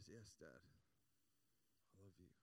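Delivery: soft, low, reluctant little answer; subdued reply. Retold or imitated. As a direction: answer Dad quietly, voice dropping away. Girl dreading request, gives in sadly.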